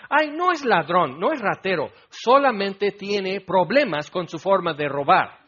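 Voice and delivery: mock the lame excuse. Preacher mocking excuses for stealing, sarcastic whine.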